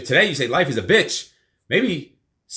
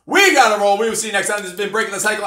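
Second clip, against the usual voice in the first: ordinary talk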